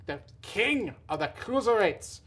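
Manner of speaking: put-on British accent, King of the Cruiserweights; lightly put-on British Neville accent; Neville impression. Wrestling recap host doing British Neville voice, playful. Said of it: "That's my best Neville accent".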